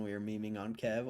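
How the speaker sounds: ordinary talk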